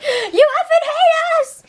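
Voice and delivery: very high, slow, tearful mock yell; mock cry yell. Imitating Chrissy cry-yelling at Justin, high and tearful. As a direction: wail it through fake tears. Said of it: "cry yelling".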